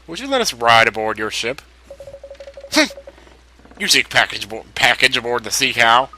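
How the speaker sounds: ordinary delivery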